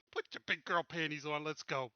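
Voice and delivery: ordinary talk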